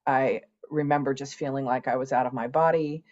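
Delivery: ordinary talk, nothing marked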